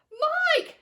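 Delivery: put-on high, loud cry of the boy's name; cry of alarm. A narrator's reading. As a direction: call out to your son in panic. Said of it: "cried Missus T V".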